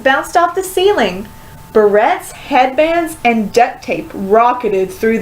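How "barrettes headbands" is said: usual speaking voice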